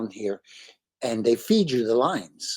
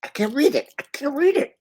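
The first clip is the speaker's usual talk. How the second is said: much higher, whining despair; high-pitched helpless whine; high whiny wail; 14 semitones higher than usual. Shown as a demonstration. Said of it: "repeated in contrasting takes".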